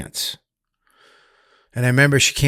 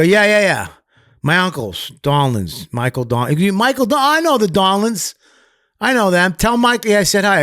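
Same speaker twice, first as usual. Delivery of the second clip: higher, louder eager name-dropping; eager retold chatter; 8 semitones higher, 4 dB louder than usual. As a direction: sell the connection eagerly. Retold eager talk to attacker.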